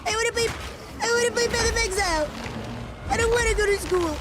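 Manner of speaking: put-on high pleading child voice; whiny kid. Gamer voicing a kid begging Mom for a pet, high whine. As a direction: beg Mom like a spoiled little kid. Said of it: "somebody throwing a tantrum".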